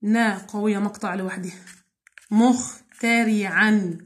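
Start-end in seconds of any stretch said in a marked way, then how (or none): 2.3-4.0 مخترعا broken into slow syllables; slow syllable demo. Shown as a demonstration. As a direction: drag each syllable for the class. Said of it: "الخاء سكون هتروح مع الميم".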